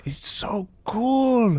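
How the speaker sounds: slow whispered amazement; awed whisper. Acted. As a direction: hush it, stunned.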